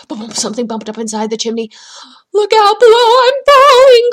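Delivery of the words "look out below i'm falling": long, high, wailing warning call; falling voice calling from high above; crying out. A narrator's reading. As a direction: holler it loud, stretching each word. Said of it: "cried a voice".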